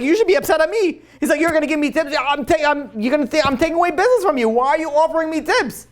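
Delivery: can't believe the rival offers help; incredulous, upset. Retold or imitated. New owner getting upset, acted.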